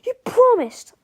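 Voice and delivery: high, loud betrayed cry; George. A narrator's reading. George reproaches Melvin for breaking his word, horrified. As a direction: protest in horrified disbelief. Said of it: "cried George, horrified".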